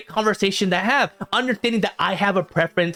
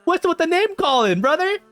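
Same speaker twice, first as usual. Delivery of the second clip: incredulous; 13 semitones higher than usual. Real, not acted. Baffled by insulting commenter.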